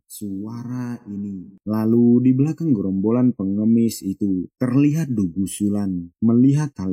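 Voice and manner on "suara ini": slow, much softer murmur of recognition; startled mutter. A narrator's reading. Father recognizing his daughter's voice, murmured softly and slowly. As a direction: breathe it to yourself, slowly. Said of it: "terkejut dan bergumam".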